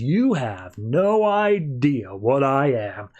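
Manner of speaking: slow, menacing reveal; ominous warning. Retold or imitated. Ulrich stopping the claws before he transforms, slow ominous warning. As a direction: draw each word out as a veiled threat.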